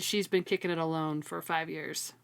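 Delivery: ordinary delivery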